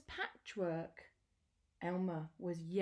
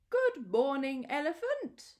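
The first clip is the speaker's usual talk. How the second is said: louder, higher animal voices calling out; loud cheery greeting; 7 semitones higher, 6 dB louder than usual. Acted. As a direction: call out a bright hello. Animals greet disguised Elmer.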